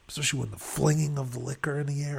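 usual speaking voice

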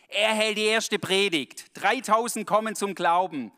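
loud, high-pitched sermon delivery; fervent preacher voice; impassioned preaching. Real, not acted. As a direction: preach it out to the congregation. Preaching at full force.

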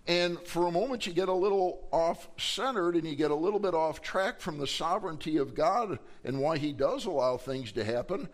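ordinary talk, nothing marked

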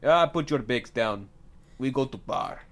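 mock Russian host; comic Russian cabbie voice; Russian accent. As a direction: give orders in a Russian accent. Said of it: "So he's Russian".

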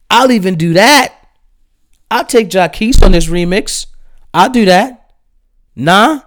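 high-pitched, disbelieving questions; incredulous rant. Real, not acted. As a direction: push the pitch up in exasperated disbelief. Ranting about the remix's guest choices.

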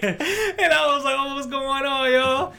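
high, slow, laughing hard; can barely talk for laughing. Real, not acted. Still laughing, retelling greeting.

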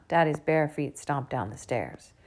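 plain reading voice

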